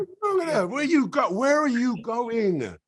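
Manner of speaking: high, loud heckling, stretched at the end; shouted disbelief. Retold or imitated. Guest imitating the cinema audience, high exasperated shout. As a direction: yell at the screen in mock exasperation.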